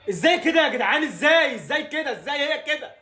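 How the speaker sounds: high, loud, incredulous; can't believe what he just saw; disbelieving cry. Real, not acted. Fan watching his defence collapse, disbelieving cry.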